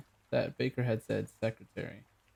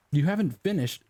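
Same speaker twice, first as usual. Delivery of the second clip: high, louder protest; indignant; 6 semitones higher, 4 dB louder than usual. Acted. Baker protesting.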